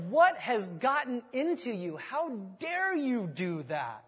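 slow, indignant imitated scolding; ticked off. Preacher voicing ticked Paul to the Corinthians, slow, indignant rebuke. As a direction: weigh each word like an exasperated telling-off. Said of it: "He's ticked".

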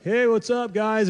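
lightly voiced casual stroll-by greeting. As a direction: toss out an easy, high hey.

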